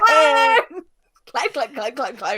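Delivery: loud shout of clone with a rising end. Pretend. Teasing co-host with the clone twist, a loud rising yell. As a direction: yell it again as a teasing question. Repeats the joke with a rise.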